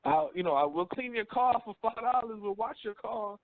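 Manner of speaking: lightly voiced kid pitching a car wash; hustling kid. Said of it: "little kids out there talking about".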